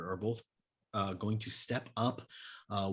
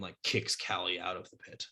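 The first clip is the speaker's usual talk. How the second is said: usual speaking voice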